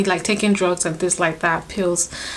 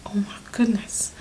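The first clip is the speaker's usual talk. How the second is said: softer, breathless "oh my goodness"; awed whisper; 5 dB quieter than usual. Retold or imitated. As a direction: whisper it in disbelief. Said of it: "I was like".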